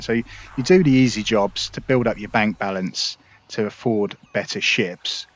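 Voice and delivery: ordinary talk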